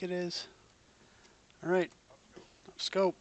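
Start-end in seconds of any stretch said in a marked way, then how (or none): 1.6-3.1 captain's order. Pretend. Captain calling up scope.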